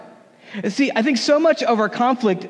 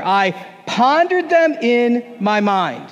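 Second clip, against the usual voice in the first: slow, drawn-out unison line; slow congregational read; 3 semitones higher, slower than usual. Shown as a demonstration. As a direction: lead the room through it slowly. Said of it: "let's all say this last line together".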